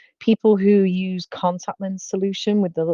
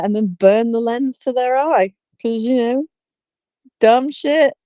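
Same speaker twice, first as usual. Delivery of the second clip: usual speaking voice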